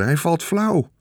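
high cry at moderate volume; mild shriek. A narrator's reading. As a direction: call out in high alarm, held back. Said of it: "gilde Veerle". (ingehouden hoge gil)

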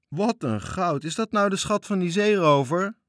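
can't believe the treasure; amazed child. Acted. Toontje seeing the pirate's gold, thrilled.

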